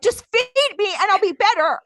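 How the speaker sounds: high, loud mock-desperate plea; irritated demand. Hungry wife begging husband for food, irritated mock yell. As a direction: demand it loudly in hammed-up exasperation. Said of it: "I was so irritated".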